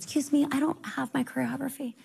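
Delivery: high, soft, small-voiced plea; timid dream self. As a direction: ask meekly, like a nervous dancer. Herself timidly asking in dream.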